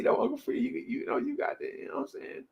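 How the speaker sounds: put-on high, soft, mumbled young guy's voice; Cully impression. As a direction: do Cully, way up and hushed, laid back. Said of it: "your impression of him".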